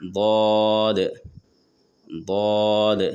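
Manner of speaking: ضاد said thick and heavy, not زواد or دواد; emphatic ض in ضاد; heavy daad. Shown as a demonstration. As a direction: give ضاد a full, heavy sound. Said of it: "موٹی آواز نکالیں گے".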